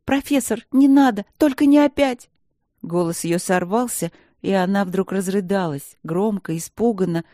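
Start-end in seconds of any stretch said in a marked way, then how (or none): 0.0-2.3 high, loud panicked begging; frightened pleading. Acted. Princess begs the professor in panic, high and loud. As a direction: beg him to stop in terror.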